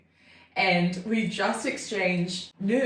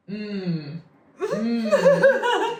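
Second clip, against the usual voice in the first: mock seductive hum; 10 semitones higher, 5 dB louder than usual. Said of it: "the opening line was".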